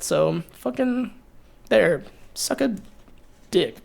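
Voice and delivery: soft, halting deadpan insult; understated jab. Real, not acted. Ending a grateful speech to former friends, soft deadpan jab. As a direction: drop it softly, word by word.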